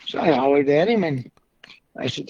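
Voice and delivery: usual speaking voice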